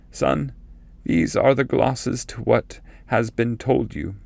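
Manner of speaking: a hint of a solemn forefather foretelling exile; ancestor's prophecy. A narrator's reading. Cacciaguida speaks again.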